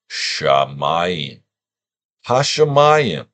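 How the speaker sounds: Ha Shamayim with a clear Sh; Shamayim, Sh sound. Shown as a demonstration. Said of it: "And you say it".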